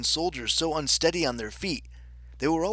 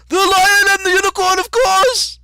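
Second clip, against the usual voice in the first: very high, blaring messenger yell; full-lung shout; 14 dB louder than usual. A narrator's reading. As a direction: bellow the obvious answer. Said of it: "shouted at the top of his lungs".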